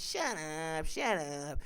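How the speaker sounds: drawn-out, sinking "shut up"; theatrical, softening dismissal; exasperated shut-up. Retold or imitated. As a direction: wave the argument away with long, fading words. Acts out dismissing others' bad takes.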